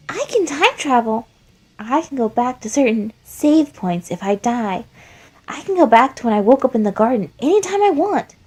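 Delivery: put-on high, loud excited kid voice; high-pitched childish bragging, loud; Flowey, boastful child. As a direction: brag like an eerie child who knows too much.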